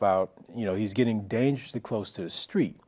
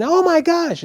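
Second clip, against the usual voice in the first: high, loud panicked cry; screaming; 9 dB louder than usual. Retold or imitated. Frantic mom over her son, screaming. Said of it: "and she's screaming".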